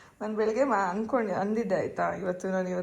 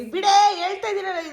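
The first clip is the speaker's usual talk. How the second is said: much higher, louder burst; exasperated shout; 8 semitones higher, 10 dB louder than usual. Real, not acted. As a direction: cut her off sharply. Telling her to stop crying.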